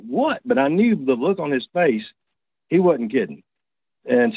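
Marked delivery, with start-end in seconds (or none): 0.0-0.4 startled question. Retold or imitated. Startled reply to panicked friend.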